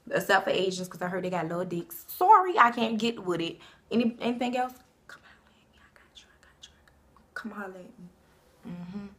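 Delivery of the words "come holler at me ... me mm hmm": breathy whispered come-on; flirty whisper. Acted. Inviting guys to contact her, soft whisper. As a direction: murmur an invitation close to the mic.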